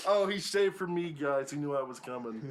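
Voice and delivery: loud laughing tease. Real, not acted. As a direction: laugh it out, teasing. Teasing laugh to the audience.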